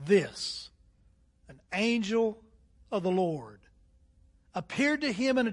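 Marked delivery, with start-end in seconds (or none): none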